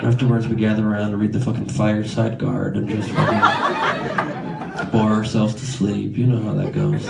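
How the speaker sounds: low, listless mock-sad delivery; sad, mopey impression. Comic imitating a man describing his volleyball hobby, mock-sad and listless. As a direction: drone it out as if volleyball were utterly depressing. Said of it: "Why do you sound so sad?".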